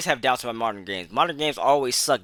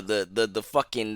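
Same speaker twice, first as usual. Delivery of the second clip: ordinary talk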